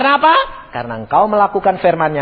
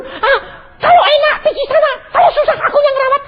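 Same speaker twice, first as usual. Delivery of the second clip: high, tearful shouted grumble; hammed-up, high-pitched griping; mock complaining wife; 3 dB louder than usual. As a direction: wail the complaint as an aggrieved wife. Acted wife complaining about husband.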